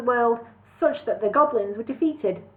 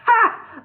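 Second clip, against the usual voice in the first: high, loud single bark of a laugh; mock their hope with a laugh; scornful villain laugh; 13 semitones higher, 7 dB louder than usual. A narrator's reading. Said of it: "laughed Cluck".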